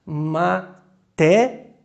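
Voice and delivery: "Matéria" drawn out slowly, the i gliding into a; slow demo word. Shown as a demonstration. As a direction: spell out "Matéria" by sound, very slowly. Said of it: "Vocês viram como é que o som do é mais discreto?". ("Matéria" bem devagar, i fraco e a forte)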